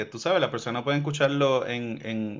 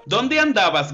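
calling the cat; 7 semitones higher, 5 dB louder than usual. Acted. Man asking the cat.

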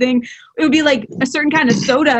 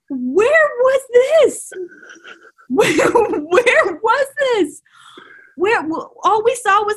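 amazed; 9 semitones higher, slower than usual. Real, not acted. Amazed by Jay Cutler.

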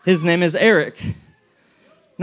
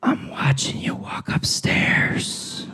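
slow, sinister whisper; drawn-out spooky stalker whisper; creepy whisper; 5 semitones lower, 4 dB quieter, much slower than usual. Acted. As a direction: whisper it like a lurking figure in the dark. Voicing the figure on the stairs.

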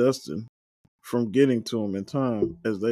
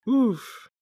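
pitched-up oof; 13 semitones higher than usual. Real, not acted. Reacting to a dodged confrontation.

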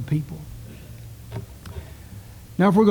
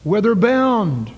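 hail; 6 semitones higher, 4 dB louder, much slower than usual. Acted. Preacher hails listeners like ships.

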